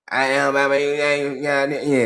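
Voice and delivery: imitating a slurred swamp-uncle babble; mumbled mock rural Southern voice; Bobby Boucher. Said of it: "They probably sound like Bobby Boucher".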